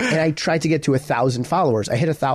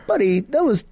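lightly put-on British accent, higher pitch; imitating a British friend, amateurishly; 7 semitones higher than usual. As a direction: attempt a bad British accent. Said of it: "in his British accent".

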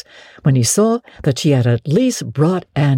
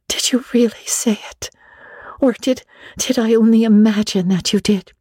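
high, breathy, barely voiced; breathless whisper; 8 semitones higher than usual. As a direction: whisper it as if afraid to hope. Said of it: "in a breathless whisper".

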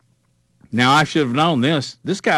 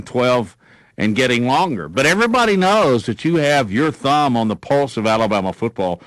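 ordinary delivery

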